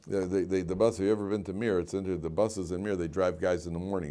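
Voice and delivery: usual speaking voice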